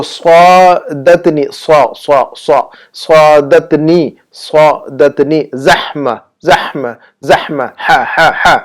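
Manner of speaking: slow صادتنی زحمہ, repeating the heavy صا and breathed ہا; pronunciation drill. Shown as a demonstration. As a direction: isolate صا and ہا, then drill them in short repeats. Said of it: "ہا اور صا کو جو ہے نا بالکل درست".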